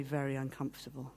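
usual speaking voice

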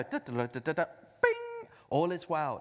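a mocking chant of a prayer said by rote; mock magic spell. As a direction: rattle it off like a magic formula. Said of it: "like a magic spell almost".

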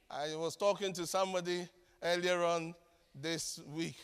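normal talking voice

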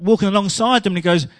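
ordinary delivery

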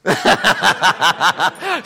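bursts out laughing at his own slip; a big genuine laugh, loud; loud real laugh. Real, not acted. Preacher laughing at his own mistake, loud real laugh.

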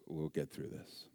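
hushed, soft fatherly reassurance; tender reassurance. Retold or imitated. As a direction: say it low and gentle, as if mid-embrace. Retelling father's forgiving embrace.

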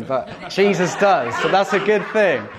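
ordinary talk

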